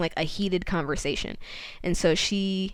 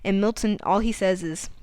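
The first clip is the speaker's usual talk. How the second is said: ordinary talk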